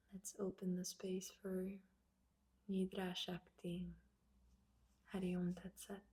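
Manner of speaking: unhurried prayer with long silences between phrases; reverent, spacious invocation; opening prayer. Real, not acted. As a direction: offer each blessing gently, leaving space after it.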